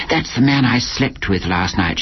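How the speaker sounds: plain reading voice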